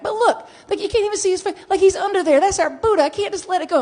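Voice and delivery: insist, beside yourself; worked-up protest. Retold or imitated. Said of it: "And I'm texting back".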